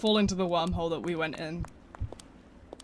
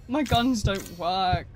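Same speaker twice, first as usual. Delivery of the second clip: usual speaking voice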